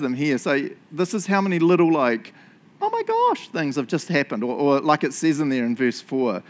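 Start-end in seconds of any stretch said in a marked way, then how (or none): 2.8-3.5 very high-pitched astonished squeak; mock amazement. Preacher marvelling at so many little coincidences, amazed. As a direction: squeak it in put-on disbelief.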